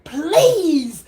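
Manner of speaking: drawn-out begging please; pleading. Retold or imitated. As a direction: plead with the Lord. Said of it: "she was".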